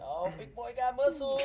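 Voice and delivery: high, drawn-out mock admiration; mocking taunt. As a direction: tease the cop in a slow, high voice. Taunting the officer who grabbed him.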